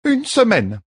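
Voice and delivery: high, firm midwife voice; midwife's verdict. A narrator's reading. Midwife orders the queen to bed, firmly. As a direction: hand down the order to rest. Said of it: "précisa Melfein".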